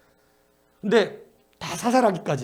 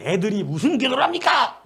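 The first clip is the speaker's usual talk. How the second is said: mocking laugh at the idea; scoffing teacher; 6 semitones higher, 3 dB louder than usual. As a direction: laugh off kids praying. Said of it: "막 이런 선생님들".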